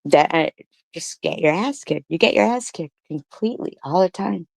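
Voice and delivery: usual speaking voice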